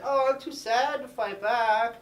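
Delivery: high, slow, hammed-up self-pity; mock mopey. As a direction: whine it slowly, feeling sorry for yourself. Beast mopes during fight.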